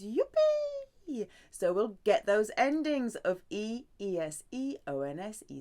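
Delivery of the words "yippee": very high, loud yippee; gleeful yippee. Real, not acted. Teacher celebrating easy endings with a high, loud yippee. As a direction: squeal it with delight.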